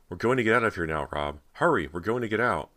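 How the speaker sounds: loud, high excited urging; triumphant. A narrator's reading. Cody promises Rob escape, triumphant loud voice. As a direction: rush him along with jubilant force. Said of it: "she cried triumphantly".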